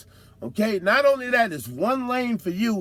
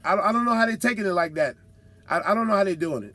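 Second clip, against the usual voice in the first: ordinary delivery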